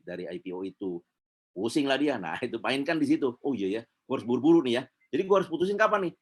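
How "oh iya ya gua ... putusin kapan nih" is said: quick, rising excited client voice; a client suddenly in a rush to decide; hurried realization. Retold or imitated. Client suddenly feels urgency. (klien yang tiba-tiba panik dan terburu-buru)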